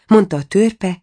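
plain reading voice

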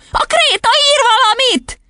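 squeaky-high excited squeal; falsetto shriek. A narrator's reading. Boy alerting the class to writing chalk, shrieking. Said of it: "visította fejhangon Otto".

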